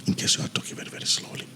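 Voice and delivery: soft whisper, barely voiced; hushed test phrase for the mic; whispered demo. Shown as a demonstration. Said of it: "let me try and talk slowly without this one".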